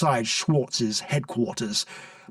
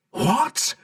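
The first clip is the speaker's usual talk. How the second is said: yelled; 5 semitones higher than usual. A narrator's reading. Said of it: "yelled the Prime Minister".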